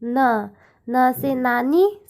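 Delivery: न said slowly as in नानी; slow letter drill. Shown as a demonstration. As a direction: drag out न, then नानी. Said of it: "अगला आता है हमारे पास न". (न को धीरे से, नानी के साथ)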